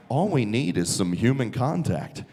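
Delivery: low voice of an inner thought; voice of his brain. Retold or imitated. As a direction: speak as his own brain, deep and coaxing. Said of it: "and my brain is like".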